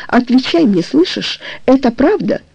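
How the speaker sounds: usual speaking voice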